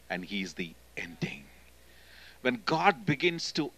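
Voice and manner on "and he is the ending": lower, voiceless whisper on "he is the ending". Acted. Preacher to congregation, hushed whisper for emphasis. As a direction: drop to a hush to close the thought.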